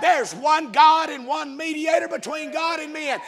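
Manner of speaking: loud, driving sermon cadence; full-force preaching. Real, not acted. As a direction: preach it out to the whole church.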